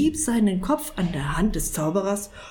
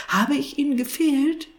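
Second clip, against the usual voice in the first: high, pleased question; delighted; 8 semitones higher, 3 dB louder than usual. A narrator's reading. Cat believing he was missed, delighted. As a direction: ask in happy hope, voice lifted. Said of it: "fragte Maurizio erfreut".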